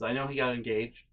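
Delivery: ordinary talk, nothing marked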